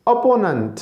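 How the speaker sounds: "अपोनेंट" as uh-po-nunt, high then falling; correct pronunciation. Shown as a demonstration. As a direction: model "अपोनेंट" with a reduced last vowel. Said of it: "इसे क्या कहेंगे जी?".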